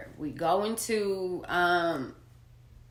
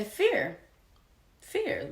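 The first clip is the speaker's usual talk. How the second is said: fear said higher and stressed, much higher; emphatic; 12 semitones higher than usual. Real, not acted. Man explaining to viewers why he refused, stressing fear. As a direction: lean on fear, pitch up.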